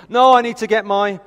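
hammed-up last-minute plea; urgent child. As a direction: imitate a child suddenly needing something. Acted child in sudden panic.

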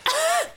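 a sharp, high startled gasp. Retold or imitated. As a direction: gasp in sudden recognition. Kurt startled by Logan.

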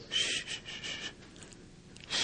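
soft, whispered "shh", repeated; soothing hush. Retold or imitated. Friend hushing a crying man he holds, soothing hush. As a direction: hush someone softly, leaving gaps.

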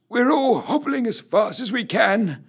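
put-on high-pitched old soldier's complaint; grumbling old corporal. A narrator's reading. Oldest corporal protesting at being hurried, grumbling. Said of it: "he added".

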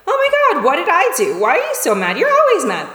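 high-pitched, exasperated mock defensiveness; taking it personally. Defensive reply to someone's anger, taking it personally. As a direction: act wounded and blaming, pitch leaping up. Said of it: "we could take that personally and be like".

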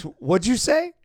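can't believe what he just heard; mock disbelief. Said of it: "And he goes".

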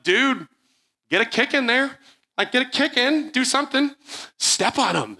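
high, urgent coaching from the floor; retold urgent yelling. Narrator on the ground urging Matt to join the fight, high and urgent.

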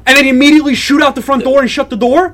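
high, loud exasperated demand; incredulous outrage. Acted. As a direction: rant as if shouting after someone. Acted outrage at parent's silence.

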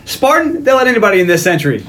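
high-pitched mock call-out, ending in laughter; taunting movie villain.

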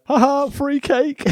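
high, slow mock chuckle on free cake; silly high gleeful voice; goofy gleeful quote. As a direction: ham it up like a slogan. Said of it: "that should be on a t-shirt".

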